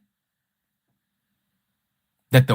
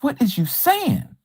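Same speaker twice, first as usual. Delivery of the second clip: incredulous; 7 semitones higher than usual. Acted. Incredulous at the opponent.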